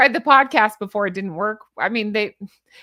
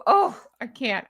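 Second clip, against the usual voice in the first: ordinary talk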